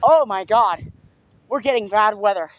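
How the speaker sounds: ordinary talk, nothing marked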